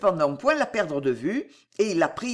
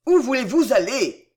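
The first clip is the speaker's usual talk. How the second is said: louder, higher repeated cry; crying out, insistent; 4 semitones higher, 6 dB louder than usual. A narrator's reading. Bridegroom calling after his silent bride, crying out insistently. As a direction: press the question harder, raising the voice. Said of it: "s'écria Delville en la suivant".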